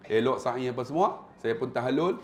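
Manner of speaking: ordinary delivery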